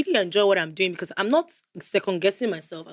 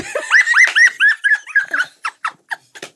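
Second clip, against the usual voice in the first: shrieking laughter; 7 dB louder than usual. Real, not acted. Laughing at her own trick.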